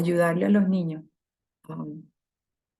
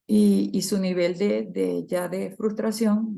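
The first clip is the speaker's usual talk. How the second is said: ordinary delivery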